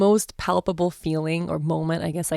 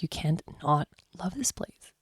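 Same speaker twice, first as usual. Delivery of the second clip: whispered in awe, barely voiced; awed whisper; 6 dB quieter than usual. Real, not acted. Whispering in awe about the farm, hushed.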